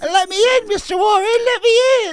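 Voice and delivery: very high, loud put-on wail; mock begging vampire child. Vampire pupil begs teacher for entry, mock tearful plea. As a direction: plead high and whiny, half laughing.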